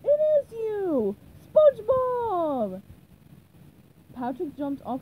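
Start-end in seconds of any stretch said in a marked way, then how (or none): 0.0-2.8 high, loud, drawn-out cheer; elated cartoon holler; joyful shout. A narrator's reading. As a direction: cry out in delighted recognition. Patrick joyfully recognizes friend.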